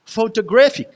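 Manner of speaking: Photographic with टो and फिक left weak, ग्रा lifted high; unstressed syllable demo. Shown as a demonstration. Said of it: "ये unstress syllables होते हैं". (Photographic में टो और फिक बिना ज़ोर, ग्रा ऊँचा)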